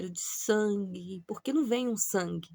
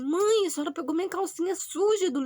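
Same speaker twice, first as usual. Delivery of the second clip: alarmed; 9 semitones higher, 7 dB louder than usual. Retold or imitated. Caught by mum with the underwear.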